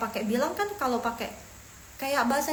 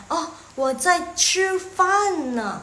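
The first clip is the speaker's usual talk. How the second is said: higher, louder, stretched-out answer; example reply; 7 semitones higher, 6 dB louder, much slower than usual. Acted. Answering while eating, higher, louder and slow. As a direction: act out the reply slowly, voice lifted. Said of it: "Atau kalian lagi makan gitu kan".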